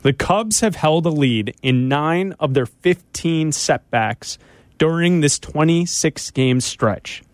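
normal talking voice

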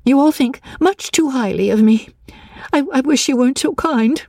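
high, agitated pleading; worked-up, high-pitched distress; overwrought insistence. A narrator's reading. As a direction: insist high, stumbling over the words. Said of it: "with clenched fists and repeated".

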